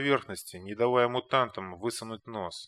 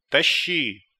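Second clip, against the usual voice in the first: loud gruff bark; barking command; 3 semitones higher, 8 dB louder than usual. A narrator's reading. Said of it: "рявкнул Кондор". (рявкнуть приказ)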